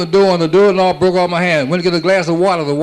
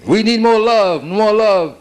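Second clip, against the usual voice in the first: mock preachy plea; 5 semitones higher, slower than usual.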